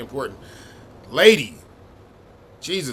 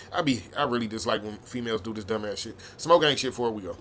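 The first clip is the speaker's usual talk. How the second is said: ordinary delivery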